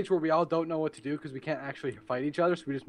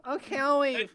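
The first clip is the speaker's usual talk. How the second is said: high put-on character voice; high-pitched fake pal; Craig; 9 semitones higher than usual. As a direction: give in as the high-voiced pretend friend. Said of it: "me pretending to be Craig".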